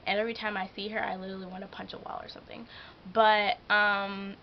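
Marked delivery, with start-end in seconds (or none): 1.0-2.7 hissed under the breath, fast; angry whisper. Real, not acted. Venting to camera about the manager she hates, angry whisper. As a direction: whisper it like you can barely hold the rage in.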